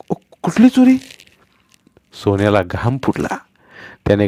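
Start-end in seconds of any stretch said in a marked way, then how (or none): 0.1-1.0 scared stammer. A narrator's reading.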